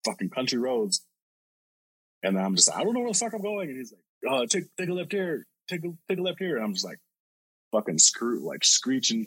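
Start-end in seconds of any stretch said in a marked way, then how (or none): 2.8-3.7 high-pitched flustered driver; retold panic. 4.3-6.5 imitated urgent directions. Retold passenger giving urgent directions.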